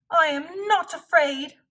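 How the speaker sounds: high, loud shout of false courage. Acted. Trembling Frog insisting to Toad, high loud shout. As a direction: shout to prove you're brave. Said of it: "he shouted".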